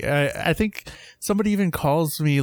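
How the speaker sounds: ordinary delivery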